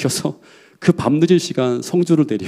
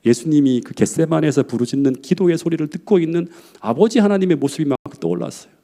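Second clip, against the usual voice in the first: ordinary delivery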